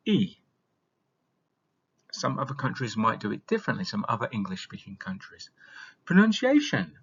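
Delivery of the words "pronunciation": lively announcing. Acted. Announcing new topic.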